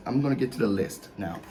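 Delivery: ordinary delivery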